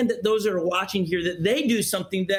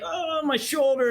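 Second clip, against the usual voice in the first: higher-pitched mock whine of pain; imitated crying child; 7 semitones higher than usual. Father retelling his son's fall, mock crying. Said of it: "he starts crying. He's like".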